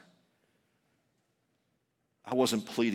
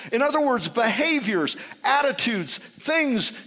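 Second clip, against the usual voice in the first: raised pitch, firm exhortation; emphatic preaching; 11 semitones higher, 5 dB louder than usual. Acted. Preacher voices John instructing soldiers and tax collectors, emphatic preaching. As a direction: lay down each instruction to the crowd. Preaches John's message forcefully.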